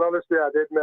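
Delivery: ordinary talk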